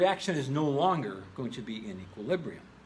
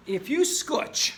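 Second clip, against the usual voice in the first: very slow and high, lingering on scotch; savoring the word; 11 semitones higher, 5 dB louder, much slower than usual. Shown as a demonstration. Teacher relishing his favorite word, drawn out high. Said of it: "I like that word scotch".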